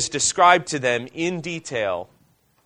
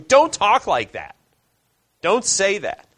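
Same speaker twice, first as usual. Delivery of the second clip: higher, louder mock rebuke from Peter; Peter's rebuke; 5 semitones higher, 3 dB louder than usual. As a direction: voice Peter scolding his friend Jesus. Peter rebuking Jesus, voiced.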